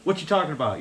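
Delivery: imitated Southern twang, asking back; Southern accent. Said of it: "I said why".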